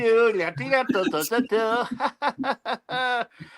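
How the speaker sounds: cartoon sailor's stuttering chuckle; Popeye. Shown as a demonstration. Showing off an impression, cartoon sailor laugh. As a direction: cackle in a rattling cartoon sailor voice. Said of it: "listen to my Popeye".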